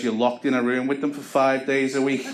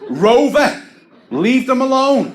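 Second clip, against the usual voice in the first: very high, loud dog-owner shout; loud high dog command; 12 semitones higher, 8 dB louder, slower than usual. Retold or imitated. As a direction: yell at him like a naughty dog. Telling off the lad like dog.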